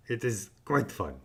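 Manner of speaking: ordinary delivery, nothing marked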